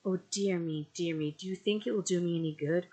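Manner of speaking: a touch of helpless pleading; helpless cry. A narrator's reading. Frightened mother asking the aunt, helpless. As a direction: plead helplessly, lightly voiced. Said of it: "cried my mother in a helpless manner".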